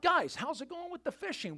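imitating a friendly yell from shore; calling out. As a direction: holler over to the fishermen. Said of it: "He yells out".

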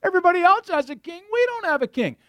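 high, fast, whiny complaining; nasal childish whine of envy. Shown as a demonstration. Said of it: "you can read that with a whiny voice".

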